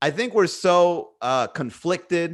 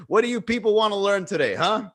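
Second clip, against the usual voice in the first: higher, louder, impatient mock lecture; cocky teacher persona; 5 semitones higher, 5 dB louder than usual. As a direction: challenge the class like you're bored. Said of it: "be like".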